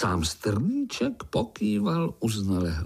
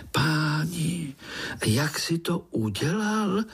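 high, amazed little voice; eager little spider; 8 semitones higher, slower than usual. A narrator's reading. Young spider asks uncle about his escape, eager. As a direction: ask high and excitedly. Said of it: "povyskočil Pája".

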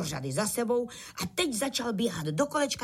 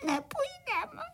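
very high, sobbing little voice; acted crying; 8 semitones higher than usual. A narrator's reading. Crickets too frightened to ride in the cart, wailing. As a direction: stammer tearfully like scared little crickets. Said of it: "malí cvrčci rozplakali".

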